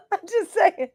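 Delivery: high, squeaky laugh through the words. Real, not acted. Woman excuses her cheeky remark to viewers, laughing. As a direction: squeeze it out through giggles.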